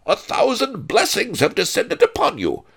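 loud, high, pompous proclamation; bellowing. A narrator's reading. Major domo announces his lord to the miser, bellowing. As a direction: proclaim it like a herald to the street. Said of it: "he bellowed".

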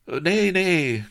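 high, loud alarmed protest; appalled cry. A narrator's reading. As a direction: cry out in horrified denial. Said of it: "cried Bilbo, appalled".

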